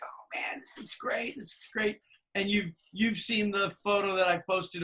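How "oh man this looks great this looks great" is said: breathy hushed admiration, barely voiced; hushed awe. Ed hushed in awe at the finished truck, whispered. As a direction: murmur it in quiet awe at the truck.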